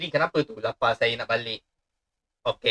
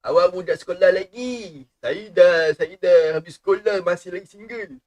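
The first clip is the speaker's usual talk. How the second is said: higher, louder mock-indignant jab; teasing; 5 semitones higher, 7 dB louder than usual. Streamer teasing a schoolkid viewer, higher and louder. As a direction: poke fun at the viewer, loud and high.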